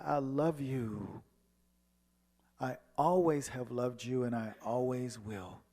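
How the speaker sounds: tender. Retold or imitated. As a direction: say it gently, with love. Tender confession to father.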